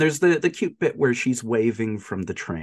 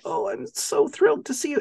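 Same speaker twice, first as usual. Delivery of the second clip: high gushing quote. Retold or imitated. Retelling the dying girl's greeting, high and gushing. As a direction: squeal it way up high, overjoyed. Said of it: "It's like".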